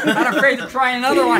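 usual speaking voice

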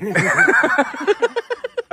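squealing laugh, way up high; high burst of laughter. Real, not acted. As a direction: crack up at the joke. Laughing at the joke.

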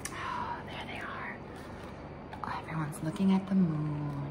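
soft hushed whisper. Real, not acted. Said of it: "aside to listener".

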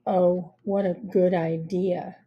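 slow, deadpan sarcasm; low, bored and dry; sarcastic. Acted. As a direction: praise it with total lack of interest. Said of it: "a sarcastic manner".

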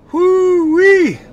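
loud high whoop from the heat; excited whoop. Real, not acted. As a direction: yell it out, mouth on fire. Reacting to very hot wings.

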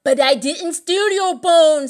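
high, loud, slow put-on voice; protesting knave. A narrator's reading. Knave of Hearts caught in the wood, high loud protest. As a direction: protest innocence in a character voice.

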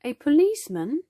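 usual speaking voice

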